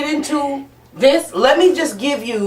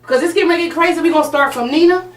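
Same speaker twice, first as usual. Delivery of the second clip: hyped-up; 7 semitones higher than usual. Real, not acted. Host hyping up the next round.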